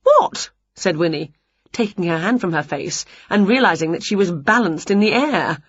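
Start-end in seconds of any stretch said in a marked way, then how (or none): 0.0-0.5 high-pitched startled yelp; sudden high exclamation. A narrator's reading. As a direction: blurt it out in surprise. Witch startled by the cheering.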